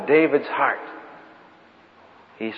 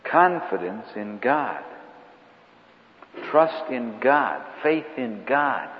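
slow emphatic; slower than usual. Acted. Preacher sums up slowly.

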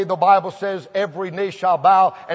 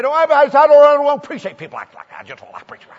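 higher, louder put-on voice of a grumpy homeowner, dropping away at the end; mock grumpy man; 7 semitones higher, 5 dB louder, faster than usual. Preacher mimicking the rude homeowner who slammed the door, mocking grump.

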